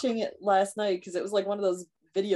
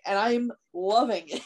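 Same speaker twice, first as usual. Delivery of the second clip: ordinary talk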